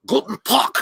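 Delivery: high, barked guttural German; mock-aggressive German, from the back of the throat; exaggerated harsh German accent. Shown as a demonstration. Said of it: "German is so guttural".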